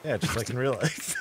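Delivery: normal talking voice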